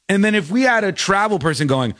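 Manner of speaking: ordinary talk, nothing marked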